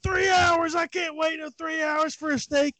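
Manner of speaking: high, disbelieving shout; retold outrage. Coach imitating his friend's outrage over steak wait, high shout. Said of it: "he was so angry".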